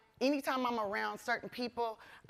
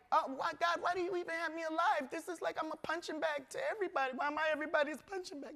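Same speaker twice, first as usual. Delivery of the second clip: high, fast, pleading to God; crying out to God; retold despairing plea; 8 semitones higher, faster than usual. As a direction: rush out the old hopeless thoughts, pitched up. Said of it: "I used to think it was the end".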